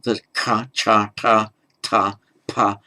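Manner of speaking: ka, cha, ta, ta, pa each with a puff of air; breathy aspirated ka, cha, ta, pa; aspirated consonants. Shown as a demonstration. Teacher showing English-style aspirated stops, demonstrative. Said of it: "pronounce an aspirated".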